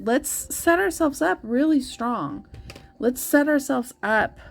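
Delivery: rally the viewers; upbeat coaching; pep talk. Real, not acted. Motivating viewers for February.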